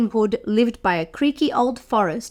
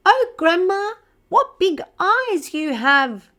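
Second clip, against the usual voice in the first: put-on higher, wondering girl voice; little girl; 7 semitones higher than usual. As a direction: sound amazed and innocent.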